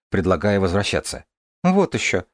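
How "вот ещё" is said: high, scornful "no way"; indignant scoff. Acted. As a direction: toss it off defiantly. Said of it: "вскинула голову Дарья". (возмущённо, с вызовом)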